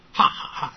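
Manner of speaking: short, unvoiced puffs of breath; demonstrating an exhaled h sound; voiceless breath puffs. Shown as a demonstration. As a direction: push out quick bursts of air, "ha ha ha". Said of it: "就是那个出气音".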